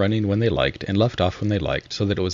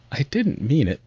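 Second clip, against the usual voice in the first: pleading; 8 semitones higher than usual. A narrator's reading. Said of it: "pleaded poor Alice".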